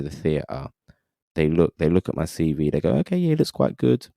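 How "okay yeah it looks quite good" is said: high-pitched, mildly approving murmur of assessment; imitated directors. Directors look at his CV, high imitated approval. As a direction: voice someone looking over a CV, pitched up.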